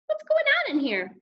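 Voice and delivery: very high motherly demand; suspicious mother. A narrator's reading. Mother opening son's door, suspicious.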